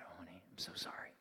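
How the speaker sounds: soft unvoiced whisper, quick and hushed; whispered apology. Father whispering sorry to his grown daughter. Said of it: "And I said".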